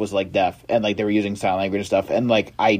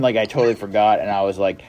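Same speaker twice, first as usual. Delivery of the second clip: ordinary talk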